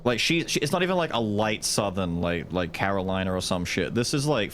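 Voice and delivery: ordinary talk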